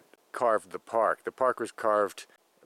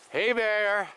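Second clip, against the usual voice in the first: scaring off a bear; 10 semitones higher than usual. Real, not acted. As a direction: call out to warn any bears. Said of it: "giving out a yell out every once in a while just to alert the bears".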